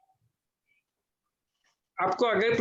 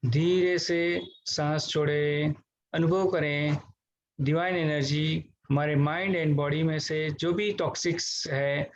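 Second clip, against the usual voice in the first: guided meditation; 4 semitones lower than usual. Real, not acted. Teacher leading online class through deep breathing, guided meditation voice.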